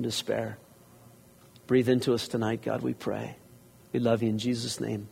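pastor's closing prayer, hushed; spoken prayer. Acted. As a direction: pray over the room gently. Leads congregation in prayer.

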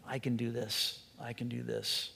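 soft, low "I can", almost confessed; subdued emphasis on "I can". Shown as a demonstration. Preacher confessing misplaced emphasis before God, subdued. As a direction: drop low and quiet, leaning on "I can". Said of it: "you put the emphasis on the "I can".

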